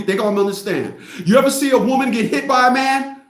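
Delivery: ordinary talk, nothing marked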